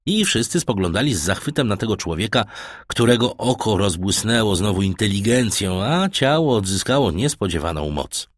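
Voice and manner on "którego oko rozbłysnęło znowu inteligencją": ordinary delivery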